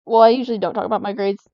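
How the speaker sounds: ordinary talk, nothing marked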